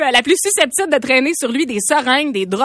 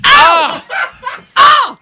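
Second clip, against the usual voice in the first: shocked gasp; 11 semitones higher, 7 dB louder than usual. Real, not acted. Host realises awkward mistake.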